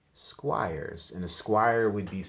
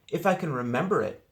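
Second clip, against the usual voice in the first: put-on nervous boy; 9 semitones higher, 7 dB louder than usual. A narrator's reading. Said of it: "said Arthur".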